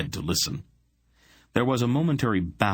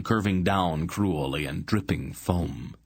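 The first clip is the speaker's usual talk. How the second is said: ordinary delivery, nothing marked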